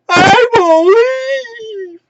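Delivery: high, stretched-out mock squeal; hammed-up wrestler catchphrase; Bo Dallas. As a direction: holler it very high and slow. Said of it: "Bo Dallas".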